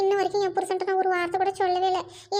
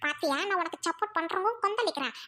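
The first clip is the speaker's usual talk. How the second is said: usual speaking voice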